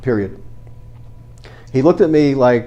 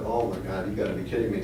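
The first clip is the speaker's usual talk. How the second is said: low, hushed, much softer disbelief; stunned disbelief; 4 semitones lower, 12 dB quieter, faster than usual. Retold or imitated. Bruce, realizing his mistake, murmurs in stunned disbelief. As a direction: breathe it out like the floor dropped. Finds the disclosure in paperwork.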